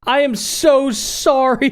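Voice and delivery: high, slow, drawn-out apology; overdone consoling coo; mock heartfelt apology. Retells consoling a heartbroken crying baby.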